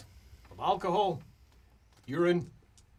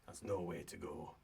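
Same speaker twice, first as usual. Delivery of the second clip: subdued dwarf; 8 semitones lower, 17 dB quieter than usual. Acted. Dwarf speaks grimly after a pause.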